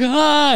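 high-pitched "ah" imitating panic; mock freak-out cry. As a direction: cry out high like someone mortified. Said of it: "he's freaking out".

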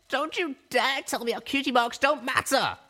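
high, fierce, venomous outburst; furious hiss. Acted. Little Pip lashing out at Calamity, venomous and grieving. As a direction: spit it at him through grief. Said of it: "I hissed".

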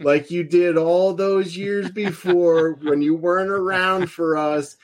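slow, drawn-out promo taunt at his dad; wrestling promo taunt. Retold or imitated. Son on the mic taunting his father, slow and bitter. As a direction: stretch each word like a bitter promo. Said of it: "the kid gets on the microphone and he goes".

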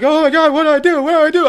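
imitating a friend's high-pitched panic; panicked friend. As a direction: squeal it like a terrified kid. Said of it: "my friend's freaking out".

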